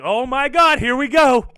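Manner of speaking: loud, high burst of excitement; high, loud hype, easing into slow, drawn-out words; hyped anticipation. Acted. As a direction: shout it like the big moment has finally arrived. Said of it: "that's like".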